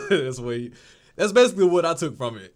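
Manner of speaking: real laughter carrying the words; laughing. Real, not acted. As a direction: still laughing as you speak. Laughing after the Jesus bit.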